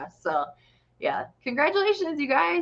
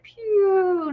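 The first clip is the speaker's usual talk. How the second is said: mock laser pew; 10 semitones higher than usual. Imitating son shooting at birds.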